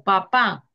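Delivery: "papá" with the weight on the final PÁ; teacher's example "papá", pa-PÁ; stress on last syllable. Shown as a demonstration. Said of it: "la mayor intensidad con que pronunciamos la sílaba".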